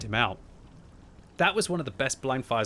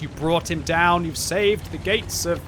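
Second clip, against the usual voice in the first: mock epic cheer; 6 semitones higher, 6 dB louder, slower than usual. Real, not acted. Cheering teammate Legolas.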